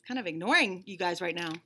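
plain reading voice

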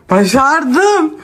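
high loud triumphant shout. Real, not acted. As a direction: cheer it out with pride. Triumphant cry after workout.

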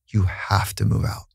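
whispered, barely voiced through tears; tearful whisper. Wife tells her husband to leave, softly whispered through tears. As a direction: breathe it out like you're crying. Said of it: "she's crying".

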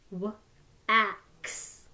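each sound said separately with a short break; sounds tapped apart. Shown as a demonstration. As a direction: split the word into its sounds, one by one. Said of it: "Let's tap out the word wax".

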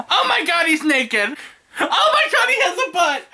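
high, squealing amazement; giddy delight. Real, not acted. Discovering the figure is naked, giddy delight. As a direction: exclaim it way up high, thrilled and amused.

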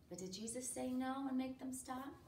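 ordinary delivery, nothing marked

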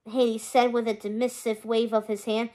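ordinary delivery

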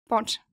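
"Bott" with a short clipped O; short vowel. Shown as a demonstration. Teacher showing the wrong short vowel, O kept short. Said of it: "sonst würde es lauten".

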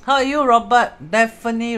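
loud greeting. Real, not acted. Greeting viewer in live stream.

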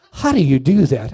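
very loud, demanding question; imitated forceful interrogation. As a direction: boom it out like an intimidating rock god. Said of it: "he says to me in this voice".

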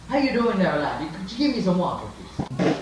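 high, lilting mock Irish accent. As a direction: greet them in a put-on Irish brogue.

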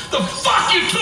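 very high mock shout; hammed-up shouted question; prisoner interrogation. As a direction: demand it in a high convict yell.

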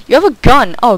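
loud, high startled yell; shocked shout. Real, not acted. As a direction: blurt it out in real shock. Shocked by armed player.

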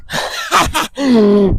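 high-pitched burst of laughter; laughing. Real, not acted. Owner cracking up at his silly horse, laughing.

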